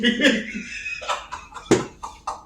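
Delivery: high, voiceless laughing fit; breathy cackle. Real, not acted. Host dying at a crude cartoon joke, wheezing laugh.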